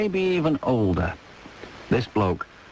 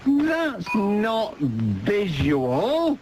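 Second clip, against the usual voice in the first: slow mock-stern; 6 semitones higher, much slower than usual. Real, not acted. Host rebuts caller.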